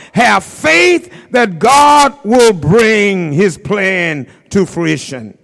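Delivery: loud, slow, emphatic preacher's proclamation; preaching at full force. Acted. Preacher assuring the church God has a plan, loud and slow. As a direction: proclaim each phrase like a promise.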